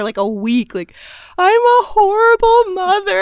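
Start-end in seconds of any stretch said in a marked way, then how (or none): 1.4-3.2 much higher, louder, slow mock wail. Crying mom blaming herself, mock wail. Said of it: "my mom was crying".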